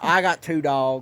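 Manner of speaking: slow, loud hammy Southern drawl; Southern accent. Pretend. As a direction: drawl it like a good ol' boy. Playing Southern ranch persona.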